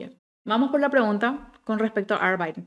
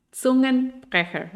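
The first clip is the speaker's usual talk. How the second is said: Zungenbrecher said slowly, higher and louder; Zungenbrecher stressed; 5 semitones higher, 5 dB louder, slower than usual. Shown as a demonstration. Teacher repeats Zungenbrecher so students catch it, slowly. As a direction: spell out Zungenbrecher slowly and clearly. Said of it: "Que rompe la lengua".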